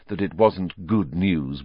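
ordinary delivery, nothing marked

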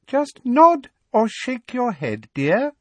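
slow, high, coaxing sweetly. A narrator's reading. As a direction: coax her like a child. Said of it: "Umbridge said coaxingly".

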